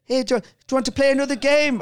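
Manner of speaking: high, loud imitated Irish boy; Irish lad. Lonely Irish kid asking for another game, high Irish voice. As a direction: put on an eager young Irish voice. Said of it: "He was this Irish".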